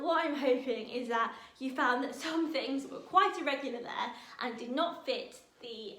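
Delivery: ordinary delivery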